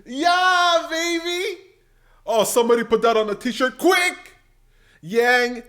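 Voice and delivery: high, loud whoop of delight; thrilled shout at the clip; excited cheer. Real, not acted. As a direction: cheer it like a home run. Cheering the debate moment.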